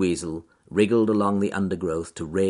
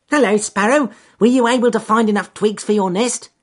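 high, loud cheerful bunny; cheerful rabbit voice; 14 semitones higher, 9 dB louder than usual. Acted. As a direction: call up brightly to the trees. Rabbit calls to sparrow.

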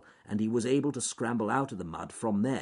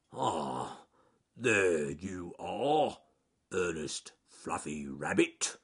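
very slow bear drawl; Charles Growly Bear; 3 semitones lower, much slower than usual. Acted. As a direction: greet ponderously, word by word. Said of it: "Charles Growly Bear arrived".

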